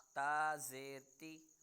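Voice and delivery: slow teacher's recitation of a Qaida drill; slow model recitation. Shown as a demonstration. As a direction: recite slowly for the student to copy. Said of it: "teacher models for repeat".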